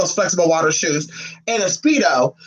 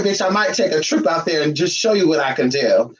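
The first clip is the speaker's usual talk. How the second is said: ordinary talk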